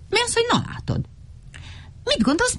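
very high, incredulous retort; squeaky little debater; challenging character. A narrator's reading. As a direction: throw it back high-pitched. Exasperated exchange over the clouds.

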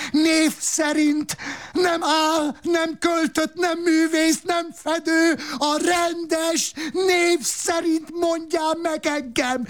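very high, slow howled question; mock howl. Roberto howling at his wife, very high and slow. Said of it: "Üvöltötte".

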